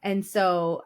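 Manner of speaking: usual speaking voice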